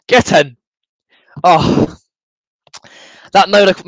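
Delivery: ordinary talk, nothing marked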